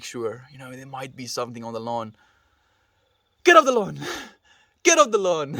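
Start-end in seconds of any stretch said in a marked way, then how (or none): 3.4-5.6 hammed-up high yell; screaming old man. Streamer imitating the old dog guarding his lawn, screaming. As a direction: yell at imagined trespassers at the top of your lungs. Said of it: "screaming at it".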